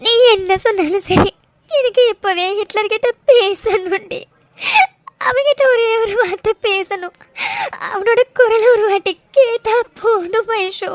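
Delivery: slow, sob-broken longing; drawn-out, tearful words; crying. Acted. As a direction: plead through tears to hear the loved one. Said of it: "அழாதடி".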